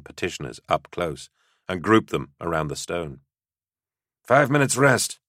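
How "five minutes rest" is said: raised, higher-pitched command; ordering. A narrator's reading. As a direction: call the order out to the group. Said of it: "he ordered".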